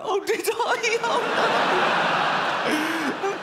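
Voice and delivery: put-on high shocked kid voice, laughing; a child gasping in mock horror; horrified child. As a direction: kid realizing what he did. Kid realizing guilt.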